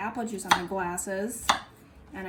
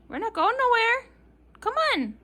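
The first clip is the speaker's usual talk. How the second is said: high coaxing; 4 dB louder than usual. Real, not acted. Coaxing the dog.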